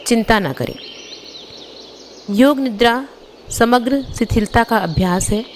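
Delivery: ordinary talk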